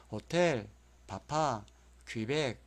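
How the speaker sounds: 호텔, 바파, 퀴벡 with weight on each final syllable; back stress. Shown as a demonstration. Teacher repeating for memorising, end stress on 호텔, 바파, 퀴벡. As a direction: land on 텔, 파 and 벡 in 호텔, 바파, 퀴벡. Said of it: "이렇게 뒤에가 강세가 있습니다".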